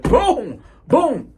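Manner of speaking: high, punched-out impact word; slam sound effect. Retold or imitated. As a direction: make each word hit the ground. Imitating a body slam.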